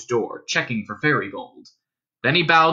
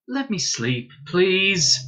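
higher-pitched, mild plea; begging; 6 semitones higher, much slower than usual. A narrator's reading. Dunstan wanting sleep from his lodger, mildly begging. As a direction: beg wearily to be left alone. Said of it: "begged Dunstan".

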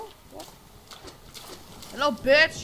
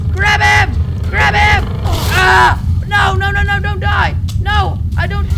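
loud desperate shouts; panicked shouting; 3 semitones higher, 9 dB louder than usual. Real, not acted. Player watching a friend die, panicked shouting. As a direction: yell in a panic as the fight turns. Teammate dying in fight.

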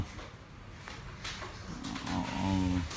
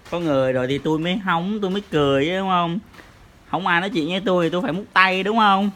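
put-on higher, quicker playful voice for the baby; speaking for baby; 6 semitones higher, faster than usual. Parent teasing baby by answering as the baby, speaking for baby. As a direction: put the baby's case in a raised, hurried voice.

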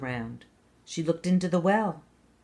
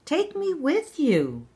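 higher, slow pleading call; pleading cry; 11 semitones higher, 4 dB louder, slower than usual. Acted. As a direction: beg her to take him along. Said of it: "cried the frog".

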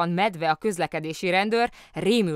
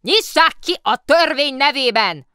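very loud, high-pitched official demand; angry shouting; 10 semitones higher, 14 dB louder than usual. A narrator's reading. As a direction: bellow an order in outrage. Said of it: "dühös kiáltozás ütötte meg a fülét".

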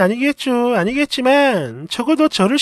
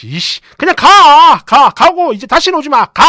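chasing her out; 4 semitones higher, 9 dB louder than usual. Retold or imitated. Husband furiously throwing his mother out, loud. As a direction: drive her out the door. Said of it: "남편이 집 밖으로 쫓아버렸어요".

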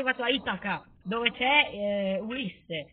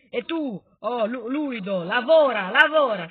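loud, raised barked order; bossy command; 8 dB louder than usual. Pretend. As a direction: drive the worker on with a shout. Orders his worker about.

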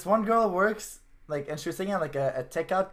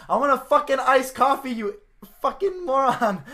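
higher, louder, slowing on the insult; furious customer; 7 semitones higher, 7 dB louder than usual. Retold or imitated. English customer yelling at the French-only girl, enraged. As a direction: yell it at the clueless barista. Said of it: "started getting fucking pissed off".

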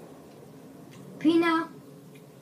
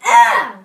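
disgusted yell; 17 dB louder than usual. Real, not acted. Tastes a gross jelly bean.

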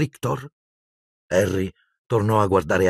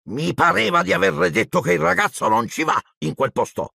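a pinched, higher uncle hissing his refusal; 7 semitones higher than usual. A narrator's reading. Uncle refusing the giant, hissing in a higher voice. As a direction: hiss it through the teeth, sharply. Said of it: "sibilò".

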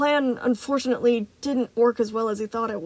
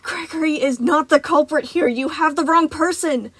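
gasped out; 6 semitones higher, 5 dB louder than usual. Acted. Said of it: "Freddy gasped out".

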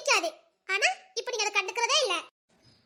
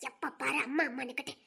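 much lower and softer, under her breath; disgusted aside; 8 semitones lower, 8 dB quieter than usual. Acted. Wife mutters contempt about husband's mother, low and soft.

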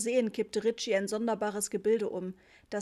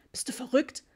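higher-pitched incredulous objection; indignant; 4 semitones higher, 4 dB louder than usual. A narrator's reading. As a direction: snap back in disbelief at your wife. Father indignant at buying a carpet. (empört und ungläubig)

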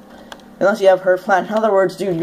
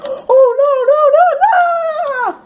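high, loud panicked cry; mock panic recalling the creeper; 6 dB louder, much slower than usual. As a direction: retell the panic in full. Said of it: "I'm like".

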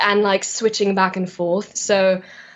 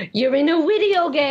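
higher, gasping relatives' astonishment; mock amazed family; 5 semitones higher than usual. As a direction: imitate family members marvelling. Imitates her amazed family's reaction.

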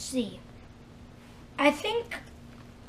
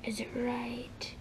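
whisper; 13 dB quieter, slower than usual. Real, not acted. Searching, whispered.

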